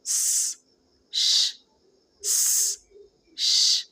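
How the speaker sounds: س whispered as a thin hiss, then ش as a fuller hush; isolated س and ش contrast; s versus sh. Shown as a demonstration. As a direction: alternate س and ش so the difference is clear. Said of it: "آپ sound میں فرق آسانی سے دیکھ پا رہے ہوں گے".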